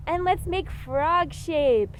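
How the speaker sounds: normal talking voice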